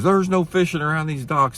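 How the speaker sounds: high, loud mock yell of a bossy dock owner; angry loudmouth. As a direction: shout it up high like a pushy local. Aggressive dock owner yelling.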